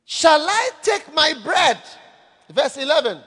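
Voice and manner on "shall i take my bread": loud, slow, outraged question; indignant Nabal. Acted. Nabal refusing bread to David's men, loud and indignant. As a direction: throw the question back in disgust. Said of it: "Nabal's indignant refusal".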